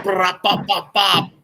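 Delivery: high mock machine gun bursts, bop bop bop; gunfire noises. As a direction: spit out the shots like a playful toy gun. Imitating machine gun fire.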